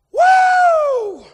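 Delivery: high, loud joyful holler; ecstatic woo. Real, not acted. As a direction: let out a piercing woo.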